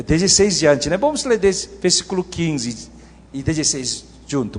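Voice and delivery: usual speaking voice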